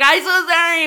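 panicked apology; mock apology yell. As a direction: blurt it out while fleeing. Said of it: "I was like".

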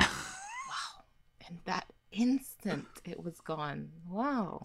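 breathy whisper, word lost; whispered disbelief. Real, not acted. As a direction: whisper it, stunned the thought vanished. Forgot what to say.

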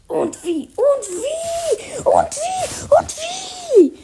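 from firm to a very high furious shout; escalating shout. Acted. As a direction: ramp it up to a screaming howl.

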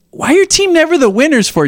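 a hint of a child questioning dad; young daughter. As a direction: raise the pitch to imitate a small daughter. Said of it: "she's like".

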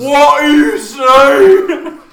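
very slow, loud, high cartoon voice. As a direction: stretch it out in a silly squeal. Voiced character reacting, laughing.

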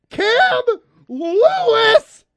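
blurting the name loud and high; sudden shout. Real, not acted. Host reading the injury list suddenly yelling a name. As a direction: jolt out the name with a shout and a laugh. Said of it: "Sorry, P T S D".

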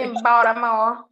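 loud mock Baltimore accent; playful Baltimore pronunciation. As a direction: say it the way they do in Baltimore. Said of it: "someone from Baltimore".

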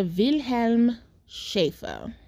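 German-accented name, "Wilhelm" with a V, drawn out slowly; put-on German accent. Shown as a demonstration. Storyteller naming the farm's owner, put-on German accent. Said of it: "I'm putting on the accent".

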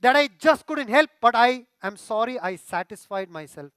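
loud, high, anguished plea to God; pleading confession. Shown as a demonstration. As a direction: pour it out as a desperate, earnest prayer. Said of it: "That is true confession".

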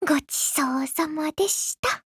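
soft whispered thanks; whispering. Acted. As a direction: breathe it as a whisper. Quiet thanks after eating.

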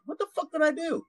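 high-pitched mock bewilderment; high, incredulous retold reaction; bewildered protest.

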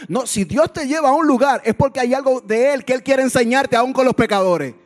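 emphatic, high-pitched preaching; preaching at full force. Real, not acted. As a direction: correct them forcefully from the pulpit.

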